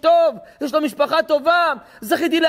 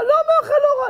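incredulous. Retold or imitated. Mock incredulous answer.

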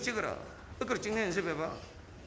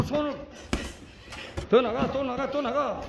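ordinary talk

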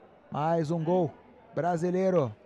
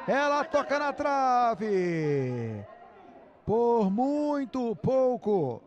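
excited near miss; 4 semitones higher, much slower than usual. Real, not acted. Near miss hits the post.

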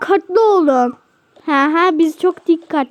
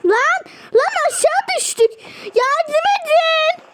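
panicked cry for help; 8 semitones higher than usual. Pretend. Fallen characters calling for someone to help, panicked cry. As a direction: wail for rescue.

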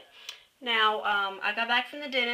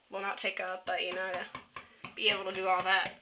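ordinary delivery

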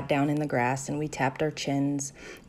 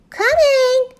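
very high, loud drawn-out call; shout; 8 dB louder than usual. A narrator's reading. Second friend answers the recess whistle, shouting. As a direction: holler back in a high voice. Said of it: "shouted Blackie".

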